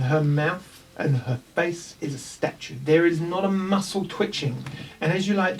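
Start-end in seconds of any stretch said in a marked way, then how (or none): none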